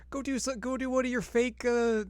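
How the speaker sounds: hammed-up high kid voice, fast at the end; Shippo. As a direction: nag like a bratty kid.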